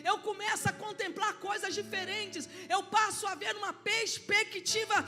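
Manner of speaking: rising, loud preaching with shouted peaks; preaching at full force. Acted. Pastor preaching to the congregation, loud and fervent. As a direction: preach it out to the church, building.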